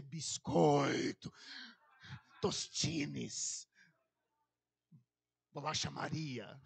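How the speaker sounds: low, drawn-out, deadpan listing; hammed-up low oracle voice; mock solemn pronouncement. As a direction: intone it slow and low as a joke. Mocking the silly message.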